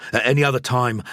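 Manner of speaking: ordinary delivery, nothing marked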